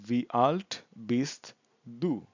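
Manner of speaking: very slow, word-by-word German; slow language demo. Shown as a demonstration. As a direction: space each word out for learners. Teacher repeats the model question. (শব্দে শব্দে খুব ধীরে)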